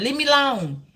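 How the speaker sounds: hammed-up toddler tantrum; mock toddler. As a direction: play a two-year-old with a temper. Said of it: "that's what the two-year-old said".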